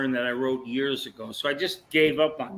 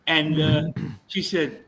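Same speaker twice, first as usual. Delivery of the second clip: ordinary talk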